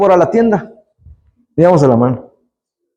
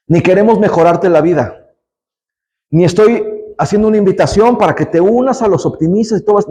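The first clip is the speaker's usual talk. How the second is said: ordinary talk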